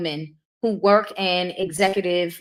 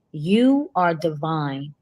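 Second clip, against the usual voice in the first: tender; 2 semitones lower, slower than usual. Real, not acted. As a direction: tell him gently he's divine. Repeated tender praise to child.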